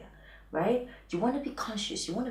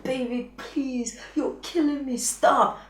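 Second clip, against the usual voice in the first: overwhelmed woman pleading; 5 semitones higher, 4 dB louder, slower than usual. Retold or imitated. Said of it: "the only thing that she wants to say".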